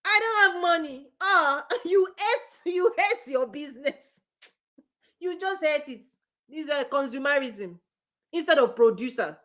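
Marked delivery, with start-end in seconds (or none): 0.1-6.0 high, loud, whining mock complaint; whiny complaining customer. Business coach mocking customers, whiny complaint. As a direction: mimic a customer moaning about money. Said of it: "And they will go".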